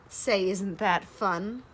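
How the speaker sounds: usual speaking voice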